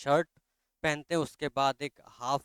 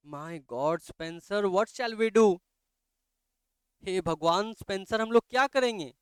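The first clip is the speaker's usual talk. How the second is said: higher and louder, rising in fear; panicked plea; 7 semitones higher, 6 dB louder, slower than usual. Acted. Mother turning to Spencer in panic, higher and louder. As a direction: cry out helplessly for help.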